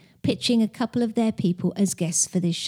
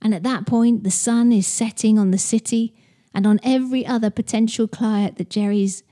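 normal talking voice

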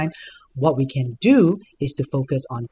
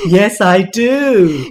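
drag queen; 6 semitones higher, 10 dB louder, much slower than usual. Pretend. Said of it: "I've got a voice of a drag queen".

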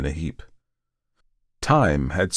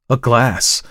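higher, louder, clearly voiced exclamation; 5 semitones higher, 6 dB louder than usual. A narrator's reading. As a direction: bring GLASS out loud and clear. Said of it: "repeated it more clearly".

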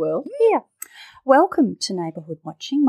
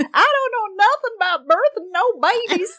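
high, laughing fake Southern voice; squeaky Southern maid impression, laughing; hammed-up Southern accent; mock Southern accent; 11 semitones higher than usual. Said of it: "butchering the Southern accent".

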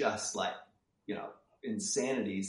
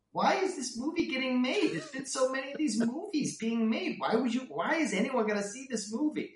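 much higher, exasperated mock boss voice; 5 dB louder than usual. Studio boss scoffing at the pitch, in a high mocking voice. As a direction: squeak out the boss's scorn in a high mock voice. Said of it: "you just hear Bob Weiss just go".

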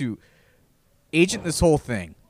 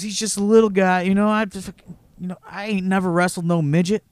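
raised reluctant Tracy; 7 semitones higher, faster than usual. Retold or imitated.

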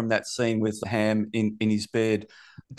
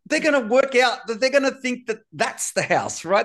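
incredulous rant; 8 semitones higher than usual. Retold or imitated. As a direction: voice rising in shocked disbelief. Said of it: "And I'm like".

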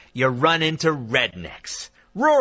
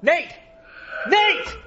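yelling a name. Acted. Yelling at producer.

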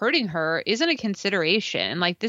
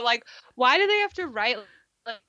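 ordinary talk, nothing marked

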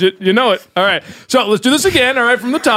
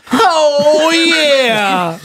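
long, high moan sliding down; climax demo; 7 semitones higher than usual. Shown as a demonstration. Caller answers with their own sound, exaggerated climax. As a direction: draw out a hammed-up climax moan. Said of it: "I sound like this".

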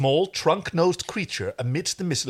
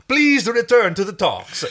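loud, high hammed-up Italian accent; 8 semitones higher, 6 dB louder than usual. Stark urging the delegates back, in an Italian accent. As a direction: play it big and Italian. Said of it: "he is Italian".

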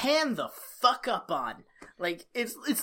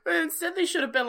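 higher, laughing through the word; 9 semitones higher, faster than usual. Real, not acted. Still laughing with her co-host, a giggling restart. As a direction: giggle as you go on.

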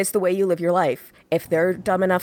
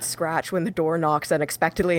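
normal talking voice

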